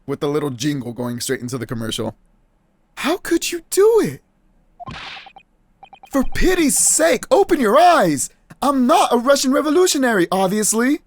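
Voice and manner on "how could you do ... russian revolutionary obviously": high, exasperated protest; high-voiced, outraged denial; indignant game hero. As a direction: plead your innocence, pitched high and emphatic.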